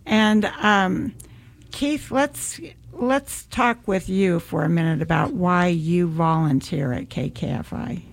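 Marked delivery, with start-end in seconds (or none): none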